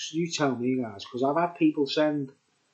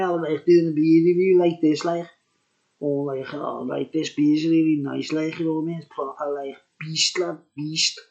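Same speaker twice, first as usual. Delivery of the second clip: hammed-up Scouse, a bit louder; a proper Scouse beer-review voice; Scouse accent; 3 semitones higher, 5 dB louder than usual. Shown as a demonstration. Vlogger demoing his beer review in Scouse accent. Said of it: "I was talking Scouse".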